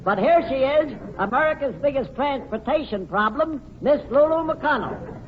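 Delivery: lifted showman introduction; host introduction. Acted. Host presenting Lulu to the audience, showman lift. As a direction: announce her with a flourish.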